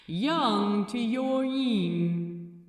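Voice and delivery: loud, measured heavenly authority; a cool celestial interrogator; voice of the Metatron. A narrator's reading.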